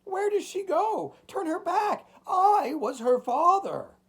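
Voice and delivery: very high, loud wailing plea; desperate cry. A narrator's reading. Old man imploring the shadows for his daughter, crying out. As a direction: beg for mercy at the top of the voice. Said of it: "cried the old man, stretching out his hands".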